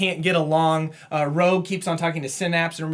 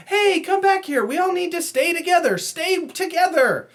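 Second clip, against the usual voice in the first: put-on much higher, whiny, childish pleading; whiny baby-ish Rogue; 12 semitones higher than usual. Recapping a comic, Rogue begs the team to stay, high whiny voice. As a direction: whine it like a spoiled kid begging everyone to stay. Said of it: "So kind of whiny baby-ish".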